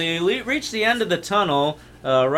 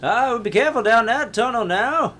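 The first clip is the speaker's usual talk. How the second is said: put-on old guard voice; 7 semitones higher than usual.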